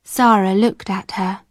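usual speaking voice

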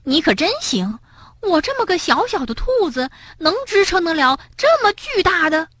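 high-pitched little rabbit protesting; indignant. A narrator's reading. Furious Bengbeng to Tiaotiao, indignant. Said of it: "蹦蹦气坏了".

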